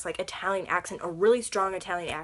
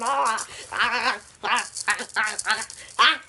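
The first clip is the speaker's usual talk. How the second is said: real yelps; 3 dB louder, slower than usual. Real, not acted. Falling over.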